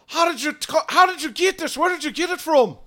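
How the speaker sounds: high, agitated questioning; alarmed druid. Acted. Game master voices alarmed druids.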